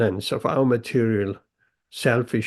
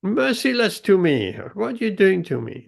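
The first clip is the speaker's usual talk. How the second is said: mock shouting pot; 8 semitones higher than usual. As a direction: beg for mercy, hammed up.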